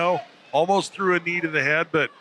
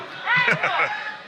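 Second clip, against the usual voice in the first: short laugh. Real, not acted. Laughs at co-host's joke.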